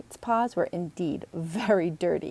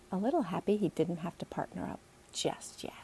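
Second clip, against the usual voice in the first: soft, unvoiced narration; whispered aside; 7 dB quieter than usual. A narrator's reading. Narrator whispers Logan's relief at working alone. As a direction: hush it like a secret.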